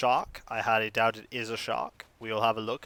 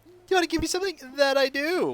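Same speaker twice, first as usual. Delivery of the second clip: villager voice; 13 semitones higher, faster than usual. Acted. Voices game villager lines.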